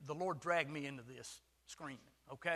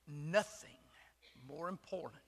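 whispered emphasis. Real, not acted. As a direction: whisper more important for weight. Sermon point.

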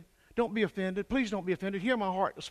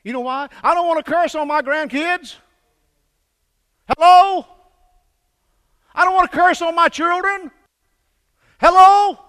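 full-throated revival preaching; preaching at full force; 14 semitones higher, 18 dB louder than usual. Acted. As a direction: drive it home from the pulpit.

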